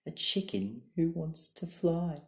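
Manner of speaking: high, loud mocking jeer. A narrator's reading. Chickens cackle mockingly at the eagle, high and loud. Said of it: "they would make fun of him and cackle".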